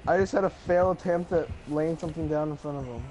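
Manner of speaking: normal talking voice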